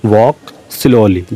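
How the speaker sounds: each word drawn out very slowly for learners; very slow dictation. Shown as a demonstration. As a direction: dictate it slowly so students can follow. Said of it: "teacher repeats phrase for learners". (बहुत धीरे, शब्द-शब्द खींचकर)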